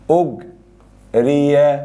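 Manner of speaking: أجري stretched slowly as ugriya; drawn-out passive form. Shown as a demonstration. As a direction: spells out أجري vowel by vowel. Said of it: "ضم وكسر". (أجري ببطء شديد بالضم والكسر)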